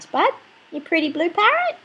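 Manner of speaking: normal talking voice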